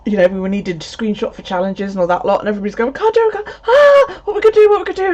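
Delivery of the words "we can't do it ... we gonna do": high, louder mock panic; panicked group. Fitbit group members panicking when the app crashed, mock panic. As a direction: squeal like a panicking crowd. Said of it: "everybody in my Fitbit group screaming".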